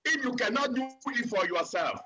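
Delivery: ordinary delivery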